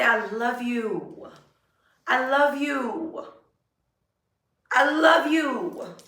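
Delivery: slow, tender I love you to yourself; tender self-affirmation. Shown as a demonstration. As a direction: say it slowly like you mean it. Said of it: "Touch your body and say".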